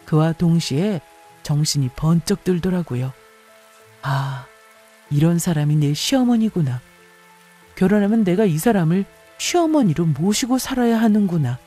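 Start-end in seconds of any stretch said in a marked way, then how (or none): none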